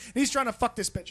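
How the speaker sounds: ordinary talk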